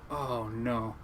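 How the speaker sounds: low, hushed dismay; quiet dread. Retold or imitated. Seasick kid hearing the next trip's forecast, quiet dread. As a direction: let it sink in, very softly. Dreading the rough weather report.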